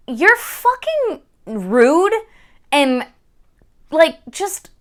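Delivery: high-pitched exasperated mock rant; irritated. Reader recounting her reaction to the heroine's escapes, irritated. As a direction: retell being fed up, pitch jumping up. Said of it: "I was so irritated".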